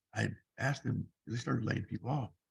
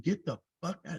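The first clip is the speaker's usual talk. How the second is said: repeated angry command, raised and pushed; angry order; 6 semitones higher than usual. Retold or imitated. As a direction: throw him out again, retold yell. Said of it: "He said it twice".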